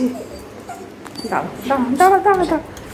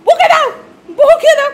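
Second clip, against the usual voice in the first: higher and louder, laughing; excited laughing shout; 8 semitones higher, 5 dB louder than usual. Real, not acted. Urging mother to scold the pet, laughing loudly. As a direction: cheer the scolding on through laughter.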